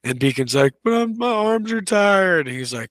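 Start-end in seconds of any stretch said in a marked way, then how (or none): 0.8-2.5 put-on high, slow whining little boy; whiny kid. Boy at the pull-up bar whines to his dad, high and slow. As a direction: whine it like a worn-out child who wants to quit.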